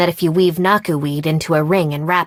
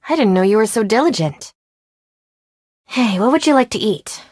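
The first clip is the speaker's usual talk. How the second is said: ordinary talk, nothing marked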